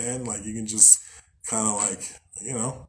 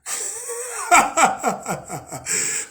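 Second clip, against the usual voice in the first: high burst of laughter. Real, not acted. Laughs at own backtrack.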